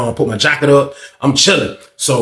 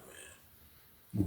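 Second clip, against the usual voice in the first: whispered; 5 semitones lower, 25 dB quieter than usual. Retold or imitated. Telling friend to move, quietly.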